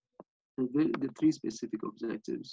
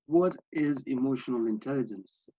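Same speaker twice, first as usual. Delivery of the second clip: usual speaking voice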